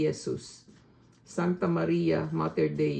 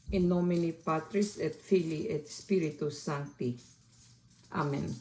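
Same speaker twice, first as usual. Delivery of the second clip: steady, earnest recitation of Latin prayer with a Filipino accent; rosary prayed in Latin with a Filipino accent; 3 dB quieter than usual. Real, not acted. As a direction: pray it from the heart, in measured phrases. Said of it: "with my Filipino accent".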